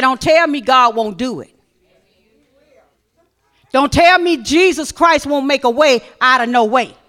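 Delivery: loud, emphatic declaration; preaching at full force. Acted. Preacher challenging the congregation, loud. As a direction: proclaim it to the church.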